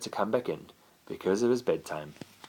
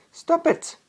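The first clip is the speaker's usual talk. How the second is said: high, sharp cry of stop it; shouted. A narrator's reading. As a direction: Emma shouts at the fighting cat, much higher. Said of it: "she shouted at Bob".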